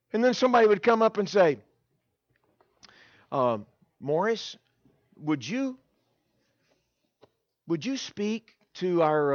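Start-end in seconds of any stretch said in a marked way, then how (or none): 3.3-9.3 slow, halting ask; imitating a tentative asker; hesitant request. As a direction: feel your way into asking a favour. Hesitant church worker asks a favour.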